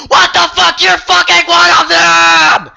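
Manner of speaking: high, loud, slow accusing yell; imagined outburst at the fake cop, shouting; outraged shout. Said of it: "I didn't say that".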